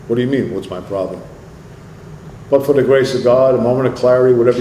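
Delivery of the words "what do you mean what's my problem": low, quiet challenge; imitating a guarded comeback, low and soft; defensive retort. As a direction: push back under your breath, pitch dropped. Repeats the retort most people give.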